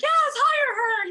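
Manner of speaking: high, loud mock cheerleader voice; mock gushing pitch. Not hyping her like a cheerleader would, mock gushing voice. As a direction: squeal it like glitter in the air.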